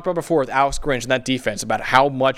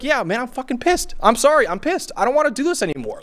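pitched-up venting, hammed-up frustration; mock pissed-off rant; 9 semitones higher than usual. Imagining himself as the quarterback, hammed-up angry venting. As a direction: play the fed-up star who can't take it anymore. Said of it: "I would be like".

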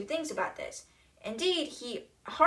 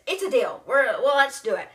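louder, eager deal-closing voice; eager acceptance; 2 semitones higher, 7 dB louder than usual. Acted. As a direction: snap up the offer, much louder.

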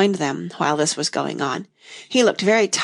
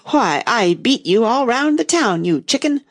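high, indignant lion retort; angry; 5 semitones higher, 3 dB louder than usual. A narrator's reading. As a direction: flare up angrily, pitch climbing. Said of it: "the lion replied angrily".

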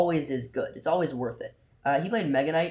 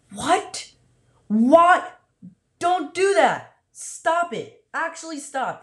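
high, loud, disbelieving yell at the screen; exasperated yelling; 12 semitones higher, 6 dB louder, much slower than usual. Real, not acted. Streamer yelling at the opponent's plays, high and loud. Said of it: "I was yelling".

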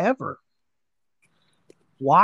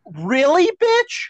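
sharply rising, much higher "Really, bitch?"; incredulous; 11 semitones higher, much slower than usual. Real, not acted. Ranting at the lying doctor, incredulous and scornful. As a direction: pitch it way up in scornful disbelief.

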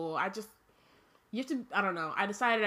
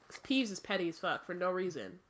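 plain reading voice

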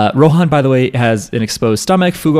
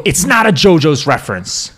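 a lightly put-on, higher defensive cosplayer; mock cosplayer reply; 5 semitones higher than usual. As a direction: brush off the fans in a silly voice. Imagined cosplayer answering fans.

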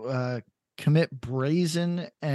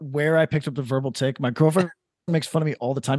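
ordinary delivery

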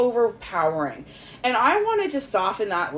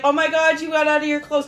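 excited; 6 semitones higher, 3 dB louder than usual. Retold or imitated. Applauding him excitedly.

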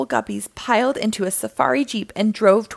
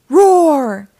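big, drawn-out acted roar, higher and louder; loud lion roar; 4 semitones higher, 10 dB louder than usual. A narrator's reading. Lion dad jumping out at the cat burglar, loud roar. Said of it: "The lion roared so loudly".

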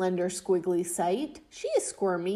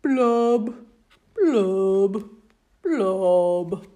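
louder sulky singsong with booming blubs; loud gloomy fish refrain; 7 dB louder, slower than usual. Acted. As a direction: belt the mopey refrain.

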